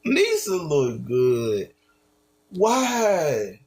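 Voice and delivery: ordinary talk, nothing marked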